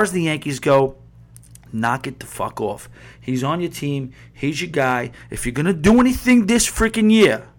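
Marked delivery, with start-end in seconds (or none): 5.3-7.5 higher and louder, real rising anger; getting mad. Real, not acted. Host yelling at Yankees fans, really getting mad. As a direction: let the frustration boil over into a shout. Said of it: "I'm sorry, I'm getting mad".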